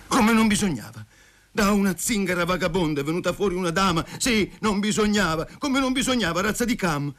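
high-pitched indignant tirade; outraged ranting. A narrator's reading. He rages at her betrayal.

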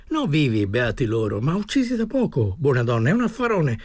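high-pitched, insistent sales pitch; eager pleading salesman. Acted. Desperate man selling his goods to an old woman, eagerly pleading. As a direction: list the goods eagerly, voice lifted.

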